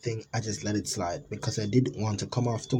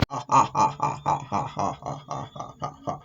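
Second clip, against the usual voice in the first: creepy laugh. Acted. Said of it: "started laughing again like a freaking idiot".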